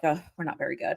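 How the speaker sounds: low, soft mocking of deflated fans; mock fan admission. Imitating fans by week four, deflated. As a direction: play fans sheepishly admitting it. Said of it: "they're like".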